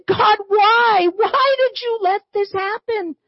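high anguished cry, shouted then dropping to pleading; crying out to God. Acted. Elijah cries out to God over the widow's son, high and pleading. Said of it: "He cried out".

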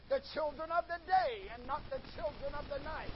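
ordinary delivery

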